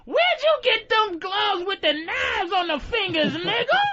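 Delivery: high-pitched, hammed-up astonishment; mock wino. Wino asking Freddy about his gloves, mock amazement. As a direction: call out in comic wonder. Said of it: "it's always a wino".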